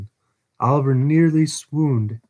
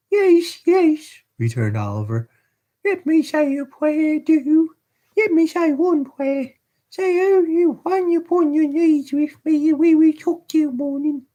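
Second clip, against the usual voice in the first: put-on very high child voice pleading; a frightened boy begging to pray; boy's voice; 3 dB louder than usual.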